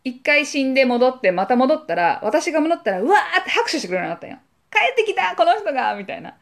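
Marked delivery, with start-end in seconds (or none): 4.7-5.9 high, gleeful welcome-back call; children cheering a returning hero; kids cheering. Retold or imitated. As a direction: cheer her back like a thrilled kid.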